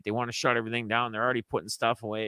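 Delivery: usual speaking voice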